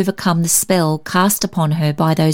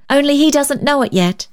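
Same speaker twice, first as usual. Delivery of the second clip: put-on high, lively little girl's voice; child's cry; 9 semitones higher than usual. A narrator's reading. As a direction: add it brightly as a child would. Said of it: "cried the child".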